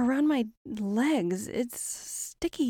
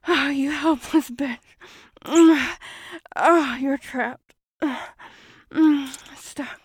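predatory creature moaning; 4 semitones higher, 8 dB louder than usual. Acted.

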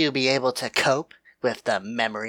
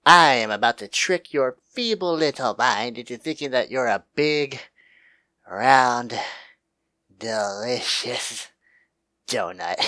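normal talking voice

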